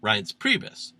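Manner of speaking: ordinary delivery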